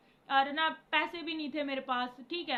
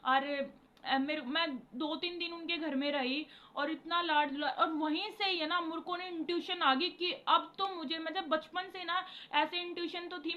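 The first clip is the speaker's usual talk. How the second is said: ordinary delivery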